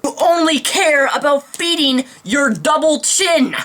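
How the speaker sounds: angry rant. Real, not acted. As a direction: tear into him with rising outrage.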